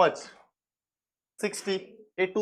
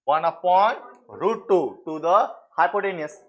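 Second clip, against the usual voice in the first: slow dictation; much slower than usual. Shown as a demonstration.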